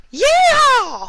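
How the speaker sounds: very high, loud battle shriek; long piercing yell. A narrator's reading. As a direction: shriek a war cry. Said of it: "Applejack shrieked".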